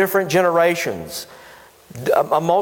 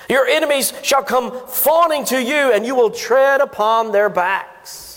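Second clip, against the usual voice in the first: Moses proclaiming; 7 semitones higher than usual. Acted. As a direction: declaim it like an old prophet on a mountain. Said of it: "Mount Moab and said these words".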